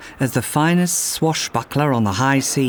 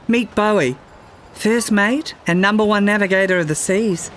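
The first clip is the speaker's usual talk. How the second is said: proudly; 6 semitones higher than usual. Acted. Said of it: "Freya said proudly".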